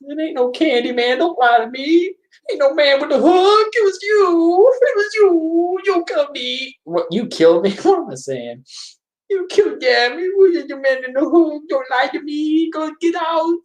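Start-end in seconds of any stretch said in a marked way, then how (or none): 0.1-6.8 very high, silly put-on panicked voice; goofy high-pitched imitation of a nervous man; dumb mock voice. Mocking a nervous film character who pleads for his life, dumb high voice. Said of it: "He doesn't talk like that. I'm just being dumb". 9.3-13.6 very high, silly put-on panicked voice; goofy high-pitched imitation of a nervous man; dumb mock voice. Mocking a nervous film character who pleads for his life, dumb high voice. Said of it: "He doesn't talk like that. I'm just being dumb".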